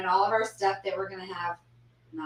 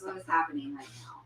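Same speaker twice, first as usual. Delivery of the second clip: whisper; 5 semitones lower, 9 dB quieter than usual. Real, not acted. Baffled by the movie crowd.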